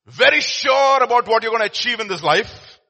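preacher to his congregation, rising to a loud peak; preaching climax. Real, not acted. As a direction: build to a ringing peak.

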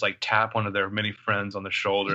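normal talking voice

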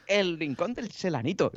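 high, slow mock Japanese tourist voice. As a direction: deliver it as the deadpan tourist punchline.

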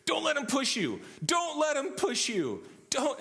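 high, loud imitation of a yelling soccer parent; mock-frantic soccer parent shouting; fired-up sideline yell. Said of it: "she starts to yell. She, she gets real fired up".

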